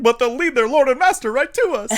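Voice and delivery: loud, high mock panic; hammed-up frightened protest; freaking out. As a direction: cry it out in comic alarm. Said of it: "the guy is freaking out".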